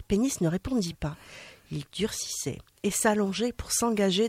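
measured storytelling read; reading a tale aloud on radio; story narration. A narrator's reading. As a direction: tell the tale to radio listeners. Reads an erotic tale aloud.

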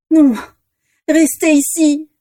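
high, slow desperate plea; pleading. A narrator's reading. Vera begs the dragons to stay in the cave, high pleading. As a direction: beg them not to leave. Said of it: "supplia Vera".

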